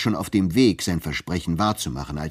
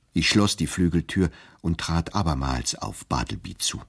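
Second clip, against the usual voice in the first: ordinary delivery, nothing marked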